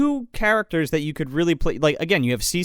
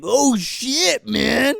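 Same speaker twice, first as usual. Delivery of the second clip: higher-pitched mock alarmed cry; imitated off-screen shock; 5 semitones higher, much slower than usual. Host imitating character's reaction heard off screen, mock shock. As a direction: call out as if stumbling on something awful. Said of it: "like, off screen, he's like".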